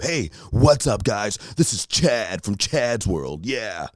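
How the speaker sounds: high, slow hammed-up YouTuber greeting; mock an eager vlogger intro. Mocking a YouTuber who greets his viewers in a hammed-up voice. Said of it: "I hate it when I see it on YouTube".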